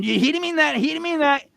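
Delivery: high-pitched frantic protest; squeaky acted-out panic; panicked denial. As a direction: stammer it out high, covering for a friend. Repeats the retold panicked denial.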